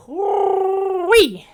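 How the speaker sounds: loud falsetto whoo-wee; high whoop. Real, not acted. Comic winding down after his rant, high whoop. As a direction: let out a high, rising whoop. Exclaims after the shrieking bit.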